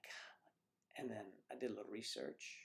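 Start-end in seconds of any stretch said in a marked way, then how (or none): 0.0-1.0 breathless whisper, no voice; whispered God. Retold or imitated. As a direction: whisper it in dismay. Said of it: "I was like".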